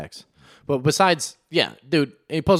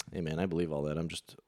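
hushed confession; 5 semitones lower, 11 dB quieter than usual. Retold or imitated. Retold comedian confiding quietly.

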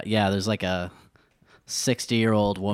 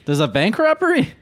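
mock surprised cop; 10 semitones higher, 5 dB louder than usual. Police acting surprised.

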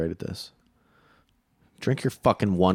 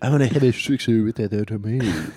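fun put-on voice. Comedian mimicking Hollywood dealmakers, silly put-on voice. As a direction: silly caricature voice. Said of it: "It's just a fun voice to do".

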